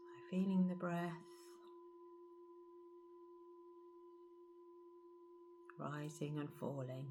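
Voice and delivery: soft, hushed meditation voice with long pauses; guided meditation. Acted. Guide leading listeners to feel the breath and body, guided meditation. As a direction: settle them gently into stillness, softly.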